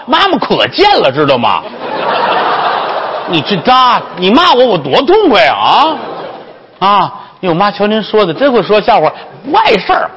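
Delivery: high-pitched gushing flattery; fawning brothel madam, eager. Acted. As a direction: laugh it off and butter her up.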